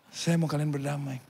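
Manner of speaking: soft, measured restatement; calm insistence. Acted. Missionary answers saddened warriors, soft calm insistence. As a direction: repeat the wish quietly and firmly. Missionary calmly repeats his wish.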